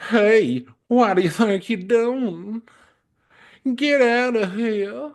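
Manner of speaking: high, slow, careful Southern accent retake. Shown as a demonstration. Retake of the dog's line in a proper Southern accent. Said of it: "That's a very good southern accent".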